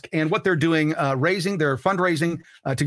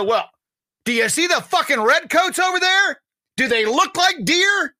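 high, loud mocking founder voice, slowing at the end; founding fathers scoffing; 4 dB louder, slower than usual. Founding father scoffing at a podcaster, high and loud. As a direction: laugh off the question, high and loud. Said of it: "They go".

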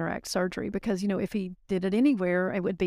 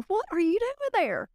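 sharply higher, startled question; surprised; 12 semitones higher than usual. Retold or imitated. Asking the crying surgeon why he's in the parking lot, astonished. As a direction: shoot up in pitch, astonished. Retold call, surprised at client.

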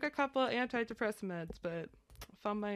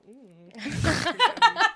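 loud sniffing hum; 6 semitones higher, 12 dB louder than usual. Retold or imitated. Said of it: "I was like".

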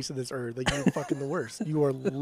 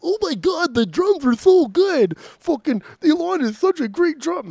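much higher, slow, louder fake-gushing fan; mocking gushing fan; 10 semitones higher, 7 dB louder, slower than usual. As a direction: ham up the starstruck fan to mock him. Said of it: "you're gonna be like".

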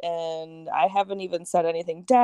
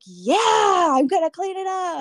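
high, loud, stretched-out mimicking shout; mock yell; 10 semitones higher, 6 dB louder than usual. Imitating the cousin's reply about the yard, mock yelling. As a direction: imitate a furious holler, hammed up.

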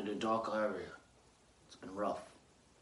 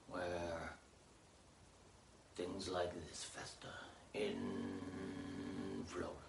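ominous hush; 2 semitones lower, 13 dB quieter, much slower than usual. Acted. Ominous descent into the armpit.

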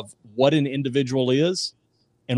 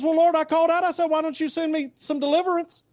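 much higher, pleading man whining to God; high whiny complaint; 13 semitones higher than usual. Acted. Dead man whining to the Lord about his rescue, much higher. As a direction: whine up to the Lord like a put-out believer.

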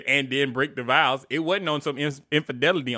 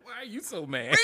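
laughing teasing question; 5 semitones higher, 9 dB quieter than usual. Real, not acted. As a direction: tease him through laughter.

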